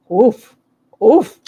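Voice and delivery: loud oof. Real, not acted. Reacting to chat comment.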